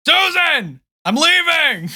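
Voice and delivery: high, drawn-out holler through the house; dad yelling a goodbye to someone in another room; calling out. Acted. Dad at the door tells Susan he's leaving, loud call. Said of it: "opens the door, and he goes".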